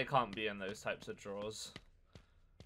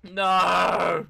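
panicked shout; 5 semitones higher, 14 dB louder than usual. Real, not acted. Refusing to hide, panic.

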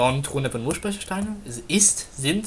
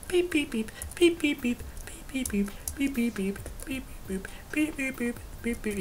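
sung beeping noise; 6 semitones higher, 7 dB quieter than usual. Pretend. Imitating game beeps while walking.

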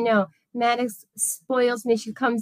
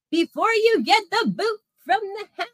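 much higher, teasing lilt; sing-song taunt; 10 semitones higher than usual. Acted. Streamer teasing the Bo Weevil about losing the house, sing-song taunt. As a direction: needle him in a singsong.

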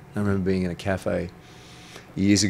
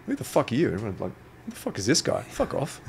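pitched-up mock outrage from the guy at the counter; hostile brush-off; 5 semitones higher than usual. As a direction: imitate the rude staff telling him off, high and sharp. Retelling the rude cafe reaction.

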